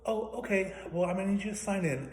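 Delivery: high mock-polite receptionist; mimicking receptionist. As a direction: put on her overly sweet high voice. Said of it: "And she goes".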